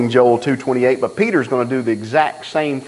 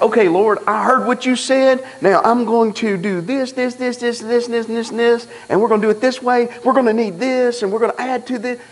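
higher, rushed know-it-all inner voice; eager planner self; 8 semitones higher than usual. Retold or imitated. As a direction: rattle off your own plans to God, higher and quick. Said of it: "I'm like".

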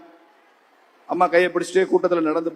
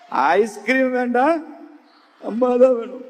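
high, drawn-out pretend whine; mock crying child; 4 semitones higher, slower than usual. Boy refuses ice cream, wants mother, high mock crying. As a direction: drag out the refusal like a tearful boy. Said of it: "அழுக நிக்கவே இல்லை".

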